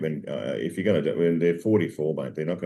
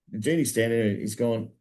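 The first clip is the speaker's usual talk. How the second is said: normal talking voice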